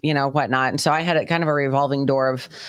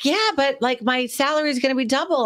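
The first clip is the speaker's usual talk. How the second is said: mocking friend; 9 semitones higher than usual. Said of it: "she was like".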